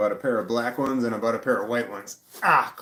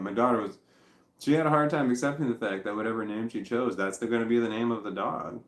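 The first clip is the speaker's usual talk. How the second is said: usual speaking voice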